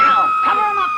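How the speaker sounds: sharp high shout; shouting order. Acted. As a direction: bark the order across the room. Granny orders the sick man covered.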